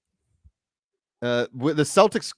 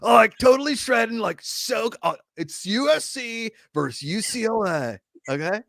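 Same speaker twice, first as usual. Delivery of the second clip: mock West Coast surfer bro accent; higher-pitched West Coast bro announcer; 6 semitones higher than usual. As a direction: call the game like a hammed-up West Coast surfer. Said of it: "whatever comes of the Pac-twelve".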